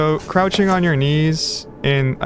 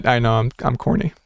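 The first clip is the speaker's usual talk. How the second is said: ordinary delivery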